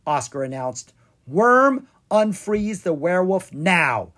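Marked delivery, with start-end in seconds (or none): 1.3-4.1 higher, louder command; yelled. Acted. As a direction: yell the order. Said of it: "Drake yelled".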